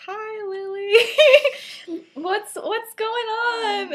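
very high, drawn-out hello; slow, high mock-innocent voice; awkward greeting. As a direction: stretch out a too-casual, squeaky greeting. Acting out awkward overcasual greeting.

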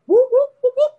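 high, loud whoops; imitated police siren. Police pull up behind him, high loud siren whoops. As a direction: mimic short siren bursts.

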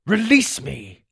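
slow, drawn-out order; commanding. A narrator's reading. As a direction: demand it as the sword's voice. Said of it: "commanded Gabriel".

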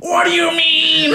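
high, slow hammed-up shout; Adam Sandler yelling in a serious drama; mock Sandler yell. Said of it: "when he's yelling at someone".

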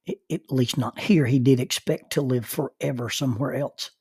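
ordinary talk, nothing marked

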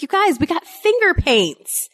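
put-on high-pitched, excited parent to kids; excited announcement. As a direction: announce a big treat to the kids.

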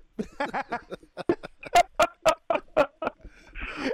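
big real belly laugh, high and loud; loud long laugh. Real, not acted. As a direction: let the laugh burst out and roll on. Laughing at the cheeky question.

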